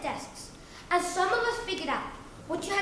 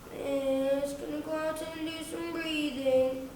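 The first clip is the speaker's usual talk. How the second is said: softer, drawn-out pupil's whine; whiny kid; 2 semitones lower, 4 dB quieter, slower than usual. Acted. Whiny kid asking miss to go out, whining plea. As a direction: plead whinily, stretching the words. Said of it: "The whiny ones".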